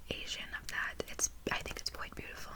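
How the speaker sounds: hushed ASMR whisper. Acted. Tutor musing to the student on language, whispered. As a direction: whisper it close and gently. Soft-spoken lesson drops into whisper.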